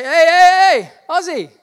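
high, loud hawker's shout; mock calling out. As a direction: holler after someone walking away, hammed-up. Mock calling after someone leaving.

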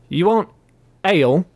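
befuddled barkeep asking; asked stupidly. A narrator's reading. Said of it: "he asked stupidly".

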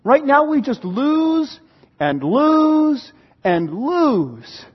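very slow, stretching each "lose"; drawn-out lament. Acted. Psalmist complains to God of defeats, drawn-out lament. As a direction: drag out "lose" wearily, one after another.

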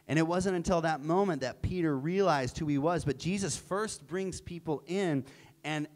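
usual speaking voice

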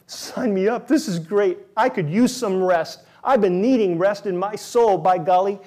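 eager. Pretend. Eager mock listener.

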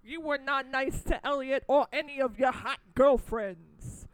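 loud New York tough-guy scolding; mock-tough New York accent. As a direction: tell him off in a put-on New York accent. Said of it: "why I'm doing this accent".

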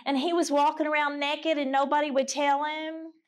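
normal talking voice

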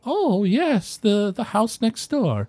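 put-on high, genteel elderly woman; an old lady recalling her neighbors. Old woman answering questions, high genteel voice.

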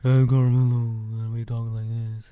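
mock his put-on deep voice; forcing a deep voice. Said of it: "this fake deep voice thing".

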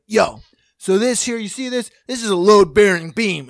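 loud bro showing off on a renovation show; roided-out bro. Retold or imitated. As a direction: imitate a pumped-up bro builder. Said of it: "roided out bros, and they'd be like".

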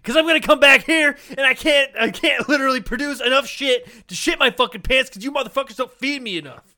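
high, loud complaining shout; ranting old man. Pretend. Old man yells at nursing home staff, high and loud. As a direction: rail at the staff.

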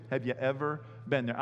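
ordinary delivery

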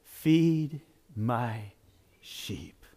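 low, spaced words, fading very soft; solemn charge. Retold or imitated. Jesus entrusting his flock to Peter, solemnly. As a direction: give a hushed, weighty command.

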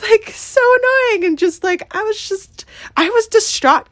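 rising upset venting about the touchy guy; upset, pitch way up. Real, not acted. Retelling upsetting touchy customer.

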